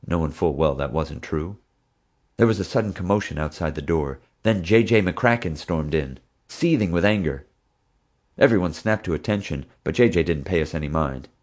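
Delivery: ordinary delivery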